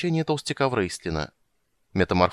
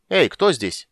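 loud, high challenge; imperious hail to a stranger; ringing, commanding; 6 semitones higher, 5 dB louder, much slower than usual. A narrator's reading. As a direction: call out with authority. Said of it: "раздался звонкий повелительный голос".

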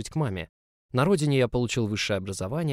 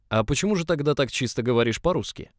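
raised, mildly irate questioning; angry interrogation; 4 semitones higher, 4 dB louder than usual. A narrator's reading. Director grills newcomer in public, raised mildly angry voice. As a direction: press him like an annoyed boss. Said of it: "не прекращал свой публичный допрос разгневанный директор".

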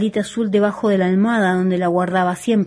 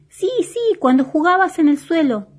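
vocecita muy aguda que discute; bolita contestona; 9 semitones higher, 4 dB louder than usual. A narrator's reading. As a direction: insiste con voz de personaje chiquito. (juguete peleador de cuento, agudísimo)

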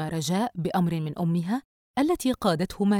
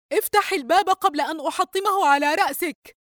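high, raised, furious warning; forceful threat; 11 semitones higher, 4 dB louder than usual. A narrator's reading. Mother threatening to break locked door on daughter, forceful. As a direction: threaten her hard through the door. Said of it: "وبقوة أكبر وهي تردد".

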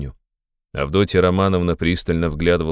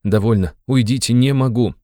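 higher, louder irritated pleading; 5 semitones higher, 4 dB louder than usual. A narrator's reading. Sick man sends his family away, irritated. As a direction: push them away with growing irritation. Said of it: "раздражительно повторял он".

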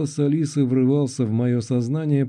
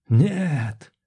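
desperate cry; 3 semitones higher than usual. A narrator's reading. Hero watches friend being dragged under.

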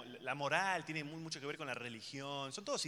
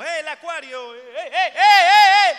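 much higher and louder hollering; hammed-up yelling; mock shout; 11 semitones higher, 23 dB louder, slower than usual. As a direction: call out like a tourist guide. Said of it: "y es como".

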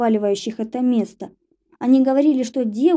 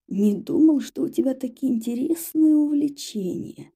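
mocking whisper; 3 semitones higher than usual. A narrator's reading. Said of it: "насмешливо шепнул Даргариец".

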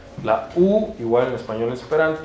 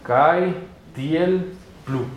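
CAITIEL PLU very slowly, each syllable separate; 4 semitones higher, much slower than usual. Shown as a demonstration. Teacher saying it in Esperanto, very slow Caitiel plu. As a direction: spell out Caitiel plu in long, spaced syllables. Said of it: "En esperanto se dice".

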